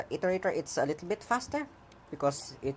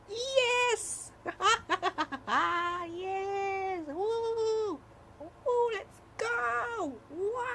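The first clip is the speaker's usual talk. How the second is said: triumphant cheer; much slower than usual. Real, not acted. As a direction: celebrate like you just won. Code finally works, celebrating.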